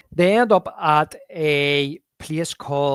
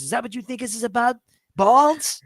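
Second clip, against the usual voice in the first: quick, rising disbelief that peaks loud on "Balls"; incredulous retort; 9 semitones higher, 3 dB louder than usual. Retold or imitated. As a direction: throw it back at him, stunned. Retold quarrel between two friends.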